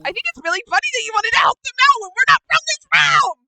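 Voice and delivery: fast, high, loud rant; sarcastic tirade. Acted. Kira berating Hyden for helping, fast furious shouted rant. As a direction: rush through it, building to a yell.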